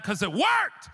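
high-pitched comic outrage, building to a shout; mock-furious disbelief, rising sharply; exasperated mad. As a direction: yell it like you can't stand that it worked. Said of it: "I got so mad".

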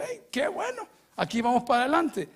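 bright, high burst of glad approval; joyful reply. Retold or imitated. Pastor tells Play he's glad, excited. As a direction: cheer the good news, high and loud.

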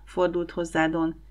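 plain reading voice

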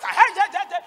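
high, very loud crowd cry; imitated shouting. Mother imitating how they shout, loud high yells. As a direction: imitate people yelling out in alarm. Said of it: "they, they shout".

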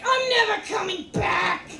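rising mock outrage. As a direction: push it up into a pitched yell. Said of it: "But it was more like".

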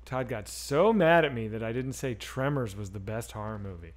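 normal talking voice

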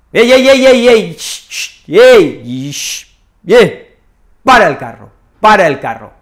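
loud "ey, ey" with a hush, then a firm "para el carro"; calling someone to stop. Shown as a demonstration. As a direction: cut the ranter off, loud and firm. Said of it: "podemos decir". (frenando a quien grita: fuerte y firme)

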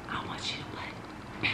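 slow, unvoiced whisper. Boyfriend whispering in her ear while holding her hands, slow whisper. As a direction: draw it out as a nervous secret. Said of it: "whispered in my ear".

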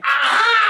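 loud high-pitched mock scream; sudden full-out imitation scream; imitated scream. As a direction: shriek like an overwhelmed woman. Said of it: "And she screamed".

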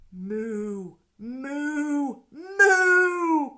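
long moos rising in pitch and volume; mooing. A narrator's reading. Duck mooing to fool the two bad men, building moos. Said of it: "the duck on the pond said".

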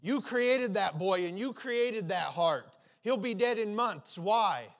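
slow, loud, angry cry at God; anguished surgeon shouting at God; fierce anger. Acted. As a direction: demand an answer from God in fury. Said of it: "His anger was fierce".

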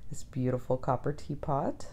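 ordinary talk, nothing marked

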